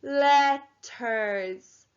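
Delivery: LETTERS stretched into two long syllables; syllable counting. Shown as a demonstration. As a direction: draw out LETTERS slowly, syllable by syllable. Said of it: "Let's count out the syl".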